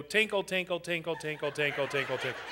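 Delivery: gentle repeated snow sound effect; snow tinkling. Shown as a demonstration. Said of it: "hands up in the air and go".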